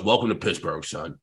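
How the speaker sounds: very low, soft paternal sign-off; imitated coach. Coach welcoming new player, low and understated. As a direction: drop deep and quiet on the last word.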